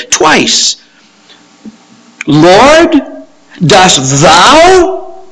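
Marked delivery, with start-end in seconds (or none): 2.3-5.2 "thou" drawn out, high and forceful; stress on THOU. Shown as a demonstration. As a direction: punch "thou" with a rising, questioning lift.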